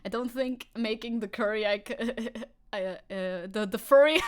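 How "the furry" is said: high, loud laughing slip; burst of laughter. Real, not acted. Laughing at his own slip.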